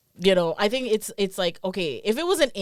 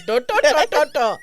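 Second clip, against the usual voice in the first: loud, higher mock Indian-accent babble; audition accent parody; 6 semitones higher, 5 dB louder than usual. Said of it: "Imagine the audition".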